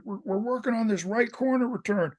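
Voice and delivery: high-pitched imitation of a coach's yelling; mock yell, mostly in the pitch; retold yelling. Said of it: "he's yelling at the coach".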